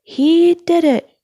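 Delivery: high, loud, slow triumphant call; announcer hailing the landing; announcer cry. A narrator's reading. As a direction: crow the win to the stadium. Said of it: "cried the announcer".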